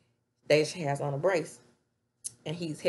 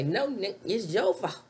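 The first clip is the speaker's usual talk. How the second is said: louder, a bit higher retort; mock accusation; 4 semitones higher, 3 dB louder than usual. Wife blaming Nate for the hot tub, mock angry retort. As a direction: throw the blame right back.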